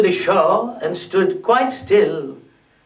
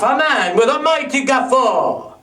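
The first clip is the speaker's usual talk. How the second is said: usual speaking voice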